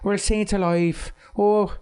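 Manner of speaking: high, cheery put-on Irish voice; mock Irish accent. Showing Brad Pitt's Irish accent in Snatch. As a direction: ham up a stage Irishman. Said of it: "he went deep with his Irish accent".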